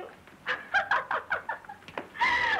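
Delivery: high shrieking laugh through tears; hysterical laugh-cry. Acted. Myra losing control in front of Roy, shrieking laugh-cry. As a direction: laugh and sob at once, out of control.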